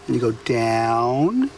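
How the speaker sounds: down drawn out slowly; elongated down. Shown as a demonstration. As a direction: stretch the word down long and slow. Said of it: "So you elongate the down".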